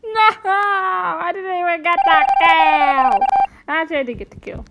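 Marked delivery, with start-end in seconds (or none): 0.0-3.2 wailing No as he dies; overacted death scene. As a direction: ham up a dramatic death. Dying as impostor, wailing.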